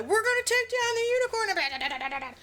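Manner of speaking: high, slow, goofy villain-ish voice; mock scientist voice. Podcaster mocks scientists plotting to take down the unicorn, high and goofy. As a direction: ham up the scheming scientists.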